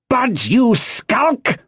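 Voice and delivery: high, drawn-out scornful yell; angry cry. A narrator's reading. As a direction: stretch the rebuke out in a high shout. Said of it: "cried Pew".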